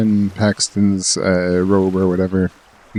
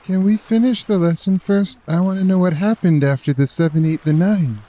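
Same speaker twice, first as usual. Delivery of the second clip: put-on much higher, eager kid; high childlike pleading; child voice; 9 semitones higher than usual. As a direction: beg like a curious child. Child voice asks.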